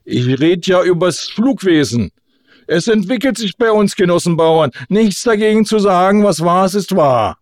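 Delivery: high, insistent self-justification; flustered orator. A narrator's reading. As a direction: keep speechifying to cover the fluster. Flustered orator resumes speech.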